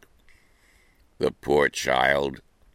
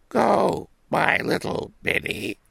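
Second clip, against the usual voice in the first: mother voice. Acted. Mother speaks to girl.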